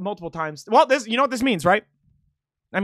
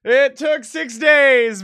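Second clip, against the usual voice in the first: loud, high and drawn out; elated, slow-motion victory cry; triumphant yell; 4 semitones higher, 7 dB louder, much slower than usual. Real, not acted. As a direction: stretch every word in a victorious shout. Still celebrating the full combo.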